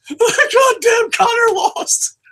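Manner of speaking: high wailing fake sob with a yell; hammed-up crying over the fighter losing; mock crying yell. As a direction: wail it like a fan pretending to be heartbroken. Mock fan crying over loss.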